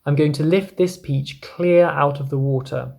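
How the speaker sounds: ordinary delivery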